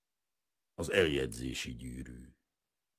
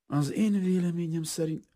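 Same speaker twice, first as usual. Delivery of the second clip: high, louder formal voice; insistent merchant; 13 semitones higher, 5 dB louder than usual. A narrator's reading. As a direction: press on with your stated opinion. Merchant pressing on, gets cut off.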